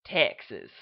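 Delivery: loud, low Texan "Texas"; broad Texas accent. Shown as a demonstration. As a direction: drawl "Texas" out proudly. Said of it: "the most obnoxious Texas accent".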